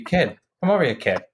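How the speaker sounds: put-on high Boston-accented call; imitated Boston coworker. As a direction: imitate a Boston guy calling someone over. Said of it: "I work with this one guy".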